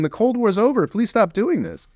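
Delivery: a pleading cry, half-acted; mild mock yell of please stop; retold scream. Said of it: "just screaming".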